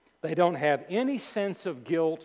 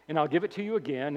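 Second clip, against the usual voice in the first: ordinary talk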